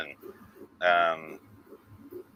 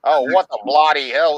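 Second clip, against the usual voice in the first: mock British; 4 semitones higher, 5 dB louder than usual. Mocking the British phrase.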